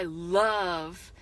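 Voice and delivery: LOVE stretched long and slow; deeply said LOVE. A narrator's reading. Wife answering husband while lover hides below, LOVE drawn out deeply. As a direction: linger deeply on the word love. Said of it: "deeply comes out the word".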